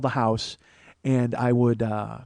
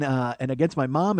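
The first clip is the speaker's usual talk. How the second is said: normal talking voice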